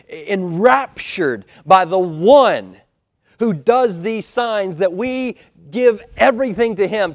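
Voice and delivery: high, loud, impassioned preaching; fervent preaching. Real, not acted. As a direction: preach it with soaring conviction. Preacher rises to full fervour.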